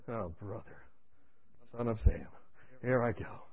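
low, soft, weary resignation; resigned mutter. Retold or imitated. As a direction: sigh it out reluctantly. Reluctant sigh before going in.

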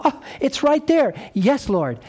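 high, bright joyful yes; eager discovery. Retold or imitated. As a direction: leap up gladly at the truth. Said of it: "they're gonna go".

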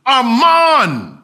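loud desperate call into a hole; frantic father shouting for his son; yelling a name. Retold or imitated. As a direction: call down into the dark, full voice. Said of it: "The father yells into the hole".

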